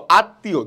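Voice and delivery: আত্মীয় with m silent and t doubled; doubled consonant. Shown as a demonstration. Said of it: "দুইবার উচ্চ".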